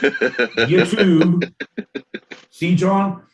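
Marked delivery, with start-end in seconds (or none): 0.0-1.5 YouTube mimicked high and drawn out; high, long mock YouTube; mocking co-host's pronunciation. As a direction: stretch YouTube high, mocking how it was read. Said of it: "you're reading things off a prompter".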